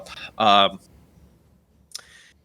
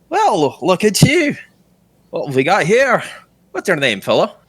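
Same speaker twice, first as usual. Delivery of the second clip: swaggering captain; 7 semitones higher than usual. Acted. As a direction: size up a stranger like a tavern captain. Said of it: "appears to be the captain".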